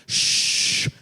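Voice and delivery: playful shush. Acted. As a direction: hush the crowd conspiratorially. Secret discount code aside.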